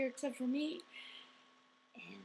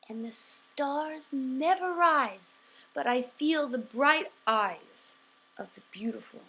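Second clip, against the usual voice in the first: dramatic verse reading, swelling then hushed; measured poem with long pauses and wide swings in volume; poem recitation; 8 dB louder than usual. Acted. Recites Poe poem for family.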